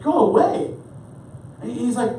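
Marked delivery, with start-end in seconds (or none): 0.0-0.7 higher, louder mock dismissal; retold angry refusal. Wife to husband at the locked door, mock-angry refusal. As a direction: imitate a wife shooing him off.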